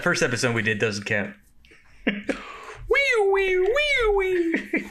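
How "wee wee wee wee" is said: very high squeaky wee wee; high squeal. Retold or imitated. Bit quoted from the dubbed movie.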